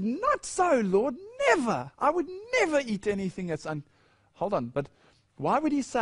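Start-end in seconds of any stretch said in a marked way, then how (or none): 0.0-3.8 high, horrified cry of refusal; mock-horrified Peter, pitched much higher; horrified protest. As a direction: recoil in shock as Peter refusing God. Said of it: "That horrified Peter".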